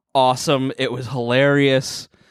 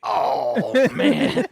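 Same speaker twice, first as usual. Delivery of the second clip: strained groan; 10 semitones higher than usual. Retold or imitated. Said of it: "And he went".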